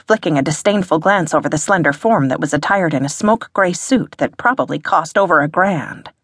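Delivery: usual speaking voice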